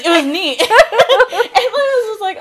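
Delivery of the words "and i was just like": laughing. Real, not acted. Laughing while retelling.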